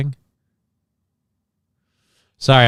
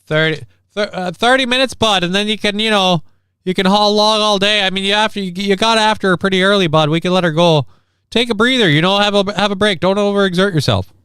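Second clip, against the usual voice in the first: higher mock Canadian accent, buddy-style; Canadian "bud" bit; 7 semitones higher than usual. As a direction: play it as a teasing Canadian, bud. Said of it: "I already do this as a bit, bud".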